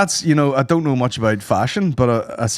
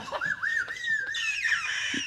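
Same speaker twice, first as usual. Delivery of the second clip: very high, soft wheeze of laughter; helpless laughter; 12 dB quieter than usual. Real, not acted. Cracks up at own impression.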